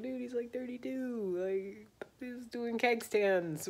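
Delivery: lightly voiced scoffing bros, slowed down; hammed-up disbelief; mocking bro voice. Admiralty mocking Fitzjames.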